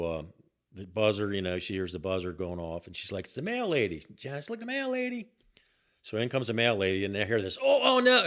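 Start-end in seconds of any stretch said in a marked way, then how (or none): 3.3-5.3 high-pitched mimicked woman's voice; retold receptionist. Retold receptionist announcing mail lady. 7.6-8.2 high, loud mimicked cry of alarm; mock alarm. Retold alarm at the scuffle.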